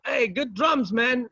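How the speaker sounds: high, mock backstage yell; retold shout. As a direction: call it out high across the room. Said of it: "a guy who screamed saying".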